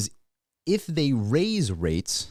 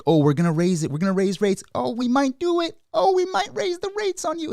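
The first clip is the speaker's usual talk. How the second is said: singsong taunt climbing much higher each line; mock teasing Fed; 13 semitones higher than usual. To the market, the Fed teasing about maybe raising rates, mocking singsong squeal. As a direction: dangle the rate hike like a tease, rising to a squeal. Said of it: "they've made it into this thing where it's like".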